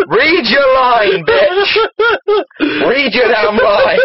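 slow, drawn-out order through laughter; laughing demand. Real, not acted. Reader prompting co-reader to read the line, laughing.

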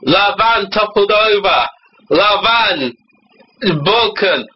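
comic fake-French officer; mock French. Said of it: "in rapid French".

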